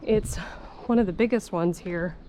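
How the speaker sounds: normal talking voice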